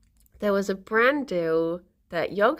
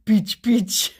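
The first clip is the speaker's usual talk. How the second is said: mock French accent; 4 dB louder than usual. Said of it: "every time I think of Peach".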